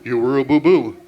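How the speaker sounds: low, loud cartoon bear; cartoon Yogi Bear voice. Dad teasing son, low cartoon bear voice. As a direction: boom it out like a big cartoon bear. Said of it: "talk to each other in cartoon voices".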